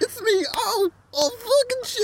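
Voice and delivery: very high squealing cries; pained kid. Retold or imitated. Voicing the son in pain.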